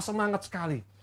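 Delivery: normal talking voice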